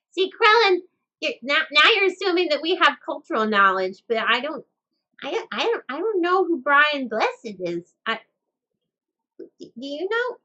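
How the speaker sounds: high, bright-eyed puppet character; squeaky little puppet talking. Acted. Puppet Sprinkles answers chat.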